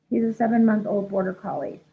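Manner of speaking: ordinary delivery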